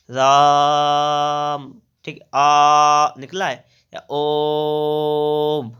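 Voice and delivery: राम called out with a very long, loud आ; drawn-out call. Shown as a demonstration. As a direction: hold the vowel as if calling across a field. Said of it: "किसी को पुकारने में". (पुकारते हुए लंबा खींचा स्वर)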